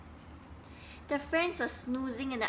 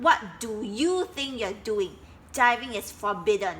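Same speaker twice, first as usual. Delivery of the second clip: loud indignant scolding; angry scolding; 6 dB louder than usual. Acted. Duck scolding the frogs, loud and angry. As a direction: tell them off hard.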